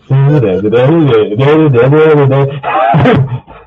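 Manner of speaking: long howling laugh; loud laughing fit. Real, not acted. Player breaking down laughing at the list, loud laugh. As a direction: howl with laughter.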